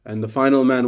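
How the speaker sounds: ordinary delivery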